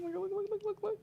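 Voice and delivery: can't contain the thrill; excited squeal. Real, not acted. Pointing excitedly at the cards.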